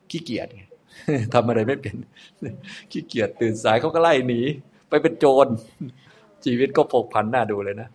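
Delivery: chuckling through the words; amused laughing. Real, not acted. Teacher amused by the lazy ex-monk's downfall, laughing. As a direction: tell it with real laughter breaking in. Amused telling of monk turned robber.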